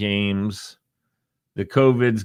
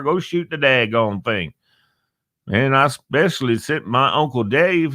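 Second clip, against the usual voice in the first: higher-pitched put-on American South accent; Southern accent; 5 semitones higher than usual. Host voices a Southerner on saving game day, put-on Southern accent. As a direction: play an emphatic Southern football fan. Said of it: "when you talk about the South".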